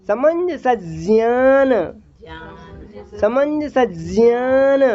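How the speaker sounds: समंजसज्ञान very slow, each syllable clear; correct Sanskrit pronunciation. Shown as a demonstration. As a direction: model समंजसज्ञान slowly for the class. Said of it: "उसका सही उच्चारण नहीं है". (समंजसज्ञान शुद्ध उच्चारण, धीरे)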